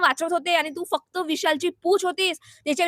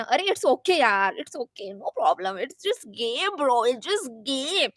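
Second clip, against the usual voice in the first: mock easy-going acceptance; 3 semitones lower, much slower than usual. Contestant brushing off elimination on the show, mock easy-going. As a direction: stretch it out like a breezy good loser. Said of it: "तेव्हा ते खूप चांगलं ना".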